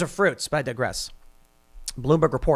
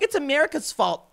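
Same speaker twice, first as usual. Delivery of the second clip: high mocking whine; sarcastic imitation; mocking whiny voice; 11 semitones higher than usual. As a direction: mimic them in a high whiny sneer. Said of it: "they're like".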